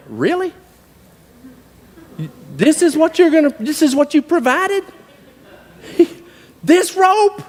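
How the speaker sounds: high, comic disbelief; incredulous. Retold or imitated. Cowboy asking God about a tie rope, incredulous. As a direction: question it as if it's an insult. Incredulous retort to God's provision.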